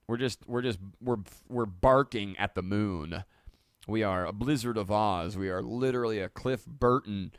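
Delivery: usual speaking voice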